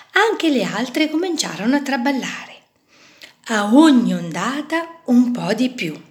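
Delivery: usual speaking voice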